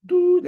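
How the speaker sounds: high, loud single toot; one imitated horn note; tekiah shofar blast. Shown as a demonstration. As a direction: hoot like a ram's horn.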